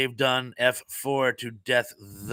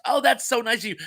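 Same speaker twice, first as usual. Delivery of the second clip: light up at the kind offer; delighted; 10 semitones higher than usual. Real, not acted. Thanking a viewer who offered to friend his neighbour, delighted.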